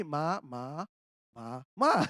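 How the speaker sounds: ma, ma, ma, ma all said in the same low tone; monotone tones. Shown as a demonstration. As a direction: flatten the four tones into one low ma. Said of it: "it sounds same all to me".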